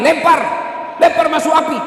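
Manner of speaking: high, loud order to throw him in; commanding shout. Acted. Prophet orders Alqamah thrown into the fire, loud command. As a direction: bark the command, urgent. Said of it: "Kata Nabi".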